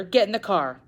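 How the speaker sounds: loud urgent command; yelled order. Acted. Father ordering daughter into the car, loud yell. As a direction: bark it over your shoulder. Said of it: "he yelled over his shoulder".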